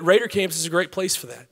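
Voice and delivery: ordinary talk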